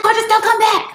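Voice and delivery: high, urgent warning voice; lightly voiced friend warning her away. Friend on the phone warning her, high urgent. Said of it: "She's like".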